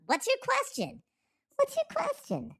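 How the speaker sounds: very high, thin falsetto; squeaky put-on voice. Asking a chat commenter his question, high squeaky voice. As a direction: ask it in a tiny squeak.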